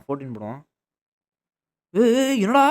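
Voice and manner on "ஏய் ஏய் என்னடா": high-pitched startled cry. Real, not acted. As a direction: react with sudden alarmed surprise. Startled by new creature in game.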